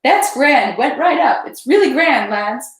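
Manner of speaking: high, loud, gleeful shout; excited cry. A narrator's reading. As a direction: cheer the fire on to the lads.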